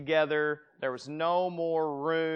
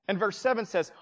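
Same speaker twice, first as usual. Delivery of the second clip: ordinary talk, nothing marked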